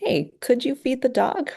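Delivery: light, questioning ask with a high rise on you. Shown as a demonstration. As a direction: make it sound like an optional request. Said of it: "phrasing it as a question or a suggestion".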